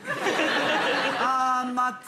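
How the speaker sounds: high-pitched, halting South African attempt; South African accent. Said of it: "he's made it South African".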